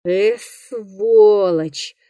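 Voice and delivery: very slow, drawn-out growl of contempt; grumbling. Acted. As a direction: stretch the insult out as a grumble. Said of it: "проворчал охотник".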